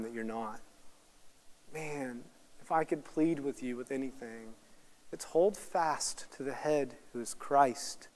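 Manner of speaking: low, soft, sober preaching; earnest pastoral plea. Real, not acted. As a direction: plead quietly with the congregation. Earnest closing plea to congregation.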